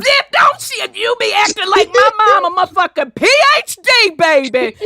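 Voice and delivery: high, loud, animated outburst; loud excited agreement. Real, not acted. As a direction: burst in loudly, whooping it up. Gleefully agreeing, mocking mom's airs.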